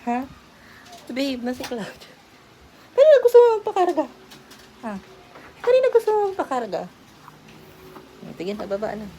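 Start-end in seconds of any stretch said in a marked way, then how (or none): 3.0-4.1 high pet talk. Real, not acted. 5.6-6.8 higher, louder, fast repeat to the cat; high pet talk. Real, not acted.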